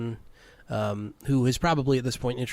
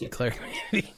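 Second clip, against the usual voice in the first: mocking echo; 5 dB quieter, faster than usual. DM echoes player's indecision.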